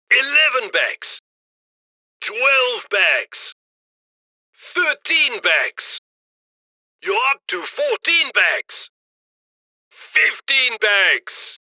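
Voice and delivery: normal talking voice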